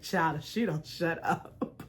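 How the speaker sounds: low, soft muttered aside. Retold or imitated. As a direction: grumble it under your breath. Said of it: "That's just how I felt".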